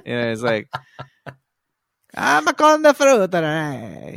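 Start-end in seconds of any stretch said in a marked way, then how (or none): 2.1-4.2 put-on much higher, slow, loud Greek accent; Con the Fruiterer. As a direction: introduce yourself as the Greek fruiterer, thick Greek accent. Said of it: "sounded just like Con the Fruiterer".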